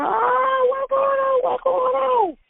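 very high, panicked cries; retold screaming. Woman woken by police at her door, reliving a panicked scream. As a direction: relive the terror of waking to a raid. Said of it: "I went to screaming".

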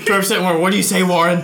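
loud, high mock pestering; hammed-up hard sell; masked elf pitch.

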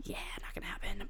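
soft whisper, quickened; whispered aside. Real, not acted. Whispering to viewers she won't catch up. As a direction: whisper it like a guilty confession.

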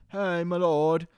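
hammed-up hail to a lord; mock game-character voice. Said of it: "I talk like this".

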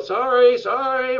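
high loud sorry, sorry; apologetic call. Retold or imitated. Dad pulling through the fans, loud high apology. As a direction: call it out the window over the crowd.

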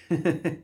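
short, high giggle; little chuckle. Acted. Molly amused while fixing the nose, chuckling.

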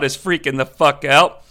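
ordinary talk

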